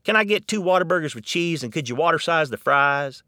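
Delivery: quick, high Texan drive-thru order; Texan accent at the counter. Retold or imitated. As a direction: order it like a hungry local. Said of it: "many of us prefer".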